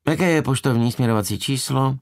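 lightly voiced young receptionist; bored receptionist. A narrator's reading. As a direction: ask it like a bored phone agent. Bored receptionist's acted line.

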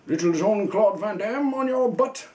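high, stretched, hammed-up taunt; playful action-hero taunt. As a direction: send the voice climbing way up in mock menace.

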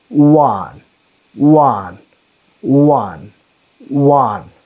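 "one" stretched, starting on a rounded w; teacher's isolated, drawn-out "one"; pronunciation demonstration. Shown as a demonstration. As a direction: draw out "one" from touching lips, falling. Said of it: "But if we pronounce one".

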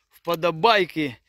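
normal talking voice

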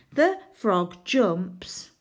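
slow, drawn out as if writing each word; slow writing read. Shown as a demonstration. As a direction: spell it out slowly while writing. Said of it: "sounding out as she writes".

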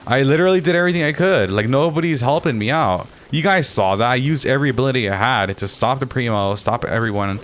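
indignant protest. Real, not acted. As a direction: plead your case to useless teammates. Protesting that teammates are not helping.

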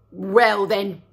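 a gruff wolf retort. Acted.

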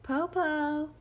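high, loud call up from the tree. Acted. Shang calling from the treetop. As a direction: call down to the wolf. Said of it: "Shang called out".

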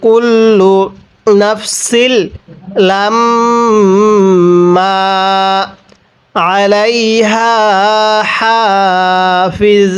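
drawn-out Quran recitation, vowels held long; slow tajweed reading. Shown as a demonstration. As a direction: chant each word slowly for students to follow. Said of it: "আর একবার পড়বেন".

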